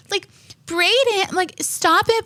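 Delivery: put-on high pleading mom voice pleading with her toddler; mock whiny mom. Mocking a mom begging her son Brayden to stop, high and whiny. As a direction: whine it like a mom who can't control her kid.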